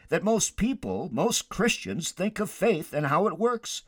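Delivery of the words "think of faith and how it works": ordinary talk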